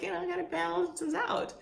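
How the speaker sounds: high mock inner voice. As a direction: voice your own thought high. Inner voice quoted.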